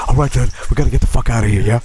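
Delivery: hurried burglar. Retold or imitated. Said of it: "It's like".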